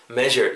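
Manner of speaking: "measure" with the J of "bonjour"; English MEASURE. Shown as a demonstration. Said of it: "이런 발음으로 해주셨으면 좋겠습니다". ("measure"를 bonjour의 j처럼 부드럽게 발음)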